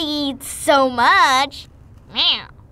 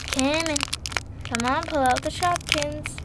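normal talking voice

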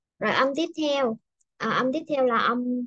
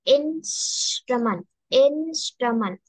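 slow model word; much slower than usual. Shown as a demonstration. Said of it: "đọc lại cho cô âm này nè".

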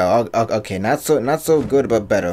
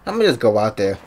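ordinary talk, nothing marked

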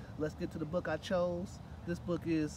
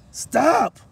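big booming shout; bellowed; 9 semitones higher, 19 dB louder than usual. Acted. Gorilla shouting after the fleeing mouse, bellowing. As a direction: bellow it like a huge ape. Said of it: "he bellowed".

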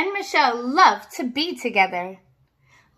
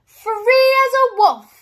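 high and louder, thrilled; yelping; 9 semitones higher, 5 dB louder than usual. Acted. Dog on the mountaintop, high loud yelp. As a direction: shout it to the sky like a freed dog. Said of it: "he yelped".

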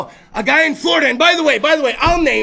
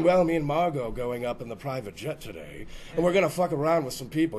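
deep, quiet snooty rich guy; lower, softer put-on rich man voice; rich jerk voice; 9 semitones lower, 9 dB quieter than usual. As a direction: play the smug rich guy dropping sausage.

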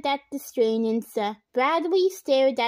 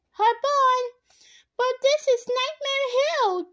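excited; 12 semitones higher than usual. A narrator's reading. Girls about to sled down.

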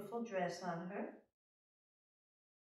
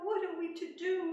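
dismayed queen; 11 semitones higher, 5 dB louder than usual. Acted. Queen despairs.

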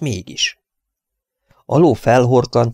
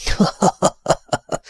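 high, breathy villain laugh; harsh cackle; 11 semitones higher than usual. A narrator's reading. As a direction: cackle rawly with a distorted voice. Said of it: "Kacagott nyersen a színtelen torszang".

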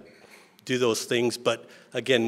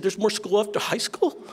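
put-on much higher, amazed child voice; astonished kids; 10 semitones higher than usual. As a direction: ask it like a stunned little kid. Kids astonished about more school.

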